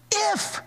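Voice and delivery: "If" leapt up high, questioning; incredulous "If". Shown as a demonstration. Preacher echoes the man's doubt, stressing If incredulously. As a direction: hang on the word "If" in disbelief. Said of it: "what do you mean, "if"?".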